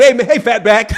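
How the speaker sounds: lightly voiced caller greeting, half laughing; a hint of mimicking the caller; mock imitation. Streamer retelling the Fatback call, lightly mimicking it. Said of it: "they said".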